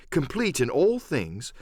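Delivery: ordinary delivery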